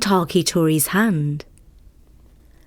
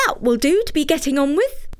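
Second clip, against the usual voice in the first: higher, light fairy voice; 7 semitones higher, faster than usual. Acted. Fairy telling Takitori she will stay, higher voice. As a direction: speak as the small fairy.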